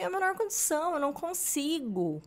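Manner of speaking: amiga esgotada, voz bem mais aguda; desabafo choroso imitado. Retold or imitated. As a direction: imitando o desespero de quem não aguenta. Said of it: "ela veio falar comigo chorando, um desabafo". (desabafo aflito, em tom agudo)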